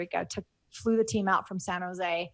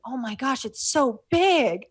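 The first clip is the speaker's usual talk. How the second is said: slow, higher, hushed wonder; awestruck; 5 semitones higher, 4 dB louder, slower than usual. Retold or imitated. Young mother seeing the ocean for the first time, awestruck. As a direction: marvel at the sight like seeing it first. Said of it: "she was so taken by the vastness".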